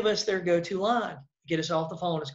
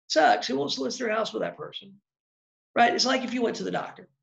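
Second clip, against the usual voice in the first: usual speaking voice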